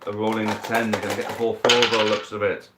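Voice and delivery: brisk sports commentator calling the match; live play-by-play. Acted. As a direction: call every roll like a match commentator. Live dice football match commentary.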